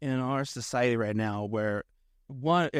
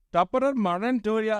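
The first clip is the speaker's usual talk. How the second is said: put-on high, botched Irish accent sounding Indian; dad's Irish accent; 8 semitones higher than usual. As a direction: imitate dad doing a terrible Irish accent. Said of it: "he'd start doing Irish accent".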